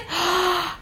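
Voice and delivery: a big sharp inhale through the mouth; deep gasp. Shown as a demonstration. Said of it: "Take a deep breath again".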